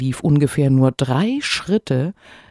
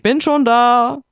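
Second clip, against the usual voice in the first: high, louder triumphant call across the field; 10 semitones higher, 6 dB louder than usual. A narrator's reading. Wife calls to the arriving hare, triumphant. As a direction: shout it from the far end. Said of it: "rief ihm der Igel entgegen".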